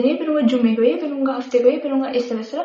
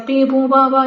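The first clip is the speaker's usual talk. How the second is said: imitated car horns; 5 dB louder, slower than usual. Imitating traffic horns and noise.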